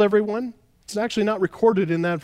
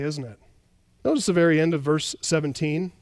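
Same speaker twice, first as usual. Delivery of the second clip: normal talking voice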